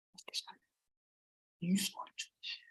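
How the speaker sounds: very soft, voiceless murmur; whispered aside. Real, not acted. Speaker to self about getting started, in a barely audible whisper. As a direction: drop right down to a whisper.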